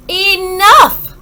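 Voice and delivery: loud high snap of enough; mother at the end of her rope; exasperated mother. A narrator's reading. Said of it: "said her mother".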